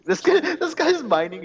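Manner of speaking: cracking up while pointing him out; high giggly amused remark; laughing. Real, not acted. Laughing at teammate in game.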